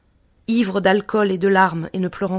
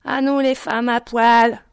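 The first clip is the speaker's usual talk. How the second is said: braillé lentement, plus haut et plus fort; ivrogne qui braille; 4 semitones higher, 4 dB louder, slower than usual. A narrator's reading. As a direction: beugler comme un ivrogne titubant. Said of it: "Il brailla". (un ivrogne braille vers Marie, lentement)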